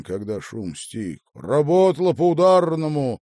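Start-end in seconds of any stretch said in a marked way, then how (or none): 1.3-3.2 high, hearty shouted compliment; loud praise. A narrator's reading. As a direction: call out approval at full voice. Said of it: "громко проговорил он". (громко и высоко хвалить)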